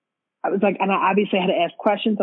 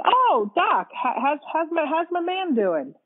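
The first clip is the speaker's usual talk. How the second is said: much higher, eager-to-hear retold greeting; upbeat greeting; 10 semitones higher than usual. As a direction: greet the doctor hopefully, pitched way up. Retold hopeful greeting to husband's doctor.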